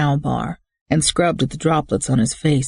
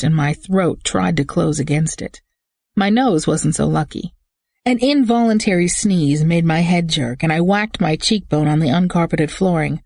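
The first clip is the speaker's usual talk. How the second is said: ordinary delivery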